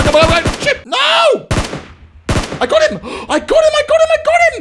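very high-pitched triumphant yells; frantic excited shrieking. Real, not acted. Player firing at an enemy, frantic then triumphant shrieks. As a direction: squeal it out in rapid bursts.

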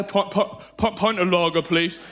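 put-on a fourteen-year-old faking a man's voice; fake grown-up voice. Underage kid ordering lager, deepened fake voice. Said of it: "you go".

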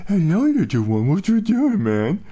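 high squeaky voice for a pet; baby talk to dog. Retold or imitated. Talking to his dog in high baby talk. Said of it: "and I'm like".

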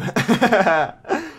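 short high laugh. Real, not acted. Gamer showing off the house, high amused laugh. Amused by own room with view.

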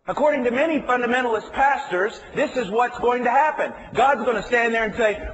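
loud, high preacher's call; fired-up sermon voice; preaching at full force. Acted. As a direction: drive every line home.

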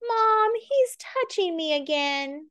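high, slow whiny tattle; whiny tattling little sibling. Acted. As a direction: tattle to Mom, whining.

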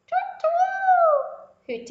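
very high owl calls; hooting owl. Acted. The owl on the barn roof hoots, very high and loud. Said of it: "hooted the owl".

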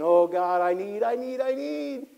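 mild mock whining to God; a hint of a needy churchgoer begging; self-centered pleading. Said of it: "I'm saying".

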